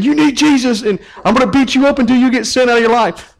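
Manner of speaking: mock aggressive preacher. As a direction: ham up a pushy street preacher threatening a sinner. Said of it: "That would be just ignorant".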